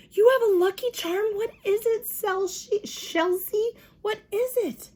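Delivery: high, eager questions; excited asking. A narrator's reading. Lucille begging Sheldon to show the charm, high.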